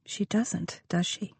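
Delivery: hushed fearful whisper. A narrator's reading. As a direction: barely breathe the question. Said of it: "Lucy whispered".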